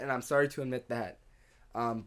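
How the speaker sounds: ordinary talk, nothing marked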